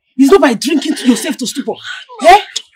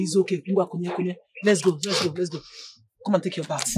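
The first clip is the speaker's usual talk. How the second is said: soft, lower coaxing; gentle coaxing; 7 semitones lower, 12 dB quieter, faster than usual. Acted. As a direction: lead her off tenderly. Mother gently coaxing daughter.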